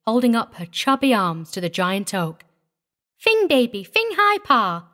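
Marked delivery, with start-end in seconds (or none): 3.2-4.9 put-on very high baby-talk plea; chirping toddler. A narrator's reading. Small child begs the oak for a swing, chirping. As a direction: pipe it up like an excited tot. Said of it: "she chirped suddenly".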